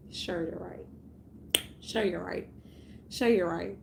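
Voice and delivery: sarcastic dismissal. Acted.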